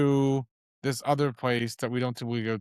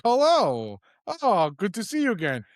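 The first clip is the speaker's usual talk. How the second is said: Boris voice; 5 semitones higher than usual. Retold or imitated. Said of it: "He's like".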